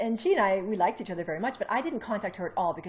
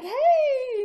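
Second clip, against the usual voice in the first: laughing hey; 10 dB louder than usual. Retold or imitated. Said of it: "he's laughing".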